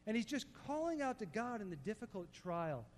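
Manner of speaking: ordinary talk, nothing marked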